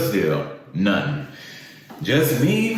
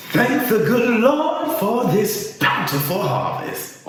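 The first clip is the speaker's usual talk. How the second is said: high, slow imitation of praying mother; mock-solemn praying mom; mom's prayer; 10 semitones higher, slower than usual. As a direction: draw out a heartfelt grace in a raised pitch. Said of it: "she'd always pray over 'em".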